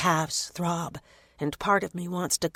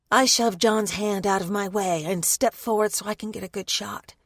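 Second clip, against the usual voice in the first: ordinary delivery, nothing marked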